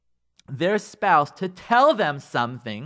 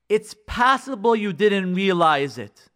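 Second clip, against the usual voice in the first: slow, measured demonstration; careful opener; 2 semitones higher, 4 dB louder, slower than usual. Shown as a demonstration. Showing spouses how to raise a hurt, deliberately. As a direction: spell it out slowly, with weight. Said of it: "talks like this".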